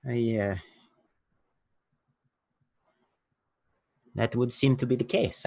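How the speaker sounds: lightly voiced Russian accent; a hint of a Russian accent.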